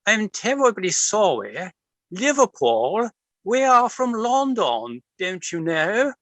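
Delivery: mock upper-class English bewilderment, Queen-like; upper-class English accent. Narrator fending off a hooligan in a put-on upper-class English accent. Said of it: "putting on my best upper-class accent".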